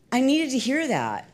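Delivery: usual speaking voice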